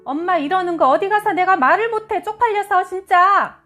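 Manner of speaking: high, loud, exasperated accusation; son's angry outburst. Acted. Son confronting his churchgoing celebrity mother, angry outburst. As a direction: throw her hypocrisy back at her. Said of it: "아들이 던진 한마디에".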